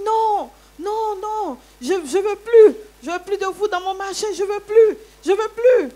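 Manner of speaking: high, loud furious refusal; imitating a furious landlady, high and loud; retold angry yelling. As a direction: bark out she wants them gone. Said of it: "c'est des cris que j'entends".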